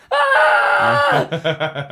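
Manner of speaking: sudden high shriek as an alarm; alarm scream. Pretend. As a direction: scream like a wake-up siren. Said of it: "change my alarm noise just to going".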